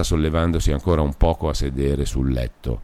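plain reading voice

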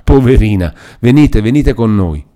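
high, loud outpouring of compassion; pitying. A narrator's reading. Good woman coaxing the frightened captive to come away, pitying. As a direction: exclaim with pity, urging her along. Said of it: "guardandola pietosamente".

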